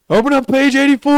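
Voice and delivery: loud barked order, high and forceful; drill instructor shout. Retold or imitated. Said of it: "drill instructor retold".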